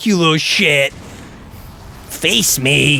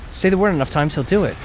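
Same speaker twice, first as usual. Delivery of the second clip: ordinary delivery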